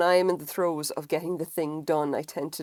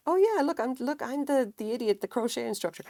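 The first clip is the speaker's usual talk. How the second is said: much higher, bright mock explaining; chirpy self-quote; 7 semitones higher than usual. Telling fifty people she is the instructor, chirpy. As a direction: play your flustered past self. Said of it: "explain to fifty people".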